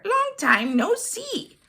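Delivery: high, cheery hare greeting; friendly hare voice. A narrator's reading. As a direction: greet an old friend brightly. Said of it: "says Hare".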